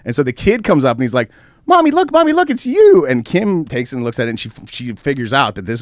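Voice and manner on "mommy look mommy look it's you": put-on high little-boy voice calling mom; kid voice. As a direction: play the excited five-year-old tugging at mom. Child voiced in retold story.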